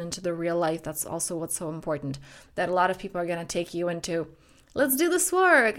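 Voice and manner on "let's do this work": higher, louder, drawn-out mock pep; eager pitch. Practitioner urging clients into the work, mock-eager. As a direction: slowly hype it up in a raised voice.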